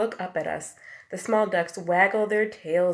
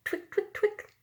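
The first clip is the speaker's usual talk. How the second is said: high, light twick sounds; toenail scratch sound. A narrator's reading. Teacher imitates dog toenails on sidewalk, high clicks. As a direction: make little scratching toenail noises. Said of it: "They go".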